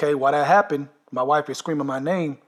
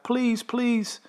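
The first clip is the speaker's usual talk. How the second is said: imitated begging; 8 semitones higher than usual. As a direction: mimic the wife begging for mercy. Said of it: "his wife is like".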